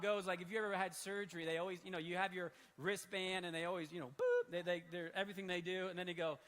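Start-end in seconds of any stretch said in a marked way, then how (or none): 3.9-4.5 high squeaky boop like a scanner; high-pitched beep imitation; imitated beep. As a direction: chirp it like a barcode beep.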